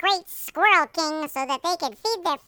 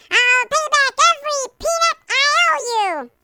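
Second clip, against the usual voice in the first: high, louder desperate promise; pleading; 8 semitones higher, 4 dB louder than usual. A narrator's reading. Servant promising the king every peanut, high pleading voice. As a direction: beg as if your life depends on it. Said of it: "he begged".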